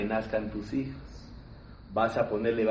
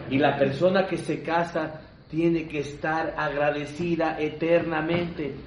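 ordinary talk

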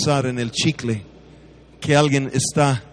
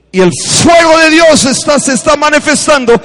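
much higher and louder outburst; sudden preaching burst; 12 semitones higher, 8 dB louder than usual. Acted. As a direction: break into fiery preaching. Preacher bursts out mid-story. (arrebato de predicador)

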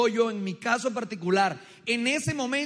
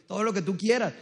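normal talking voice

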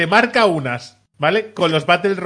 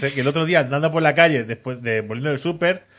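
ordinary talk, nothing marked

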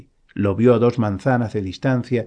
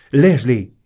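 raised, narrated shout of a name; calling out; 2 semitones higher, 6 dB louder than usual. A narrator's reading. Man shouts after a woman in a passing car, calling out. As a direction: call out to someone in another car. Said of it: "gritó".

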